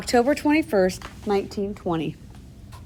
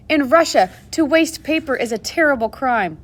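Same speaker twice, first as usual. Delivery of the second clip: indignant; 5 semitones higher than usual. A narrator's reading. Rivka protests to the nurse.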